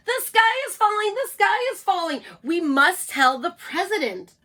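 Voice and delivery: high, loud panicky clucking; clucking hen voice. Acted. Chicken Licken panics to her friends, high clucking cry. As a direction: squawk it out like a frantic hen.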